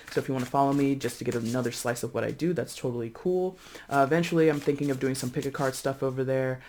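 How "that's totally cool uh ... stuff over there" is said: normal talking voice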